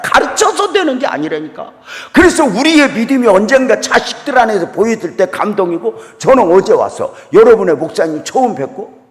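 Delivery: high, loud preaching at full force; high-pitched, loud pulpit exhortation; fervent preaching. Acted. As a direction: preach it out like a revival sermon.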